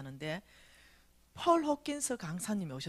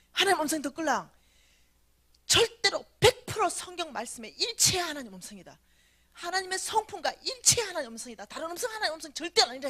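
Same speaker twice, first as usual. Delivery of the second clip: loud, raised-pitch emphatic argument; insistent challenge; 7 semitones higher, 6 dB louder than usual. Retold or imitated. Fourth-generation believer arguing with God, loudly insistent. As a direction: lay down the rule, point by point.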